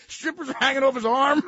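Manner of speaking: usual speaking voice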